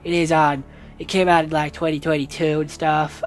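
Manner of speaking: put-on half-hearted Southern American accent; bad Southern American accent. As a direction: play a Southern American, badly and on purpose. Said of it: "I'm gonna stop with that accent 'cause it's terrible".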